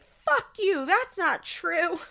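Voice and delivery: high, loud mock yell; mock furious outburst. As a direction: shriek back in exaggerated outrage, dragging the last words. Acting out Amber's furious retort.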